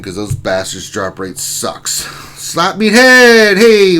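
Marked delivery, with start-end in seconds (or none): 2.4-4.0 slow, much higher and louder call of "Slap me head"; big welcome. Acted. Streamer greeting viewer Slap me head, announcer-style call.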